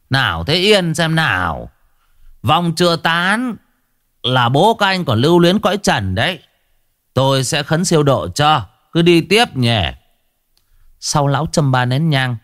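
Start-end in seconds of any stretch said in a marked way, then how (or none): 0.1-9.8 high, ringing commands; commanding priest. A narrator's reading. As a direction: order the crowd to stay calm. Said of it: "hô to".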